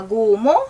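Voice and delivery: GUMO stretched with voiced g, rising end; wrong hard G. Shown as a demonstration. Teacher rejects a misreading for learners, rising GUMO with voiced g. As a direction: lift GUMO like a question, full g. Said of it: "Hindi siya".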